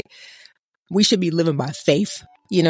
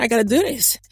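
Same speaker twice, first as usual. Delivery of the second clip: higher-pitched, mock-reluctant imitation; mock lukewarm; 5 semitones higher than usual. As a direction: mimic half-hearted resignation to a chore. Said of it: "Do you think Jesus lukewarmly got on the cross?".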